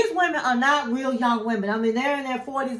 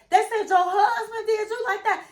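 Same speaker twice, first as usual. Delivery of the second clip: high retold quote; 10 semitones higher than usual. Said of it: "And she said".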